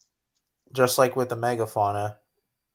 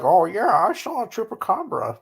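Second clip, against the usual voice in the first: high-pitched, swooping preacher impression; Ken Hovind; 8 semitones higher, 4 dB louder than usual. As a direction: do an exaggerated, higher-voiced impersonation. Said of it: "That's a pretty good Ken".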